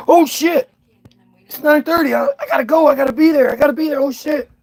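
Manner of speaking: high, loud frantic rush; frantic high voice, late for the meeting; panicked. Pretend. Panicked realizing he's late.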